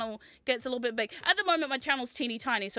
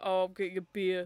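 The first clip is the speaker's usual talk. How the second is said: imitating father; 5 semitones lower than usual. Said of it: "'cause he's like".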